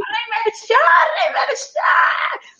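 put-on high excited children shouting; calling out. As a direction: kids yelling for the king.

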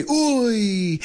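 high groaning uy; imitating Spanish uy. Shown as a demonstration. Host imitating a Spanish crowd's uy at a missed penalty. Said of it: "And you say".

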